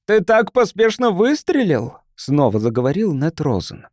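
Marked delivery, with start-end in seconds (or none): 0.1-2.0 high, pointed accusation; accusing. A narrator's reading. Ned Rosen confronting the man who fired, accusing. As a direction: throw the charge at him.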